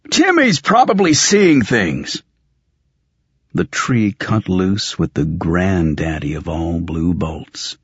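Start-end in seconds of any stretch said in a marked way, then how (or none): 0.1-2.2 high-pitched, exasperated snarl. Acted. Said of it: "Tully snarled".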